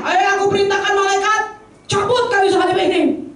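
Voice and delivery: high, imperious order; mock command. Preacher imagining Jesus on the cross ordering angels, high and commanding. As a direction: act out a grand summons to the angels.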